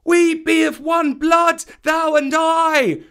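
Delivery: high, ringing call through the trees; kite call shout. A narrator's reading. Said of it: "give the kite call".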